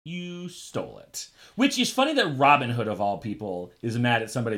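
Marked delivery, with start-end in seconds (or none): 0.0-1.3 slow, hushed verdict after a pause; drawn-out, quiet reveal; mock accusation. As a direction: let the accusation land softly and slowly. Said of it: "And then he pauses".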